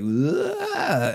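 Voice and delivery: high, drawn-out mock scream; cartoon yell. Retelling friend sliding toward the door, high cartoon yell. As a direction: imitate a cartoon character skidding out of control. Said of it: "slid like a cartoon character".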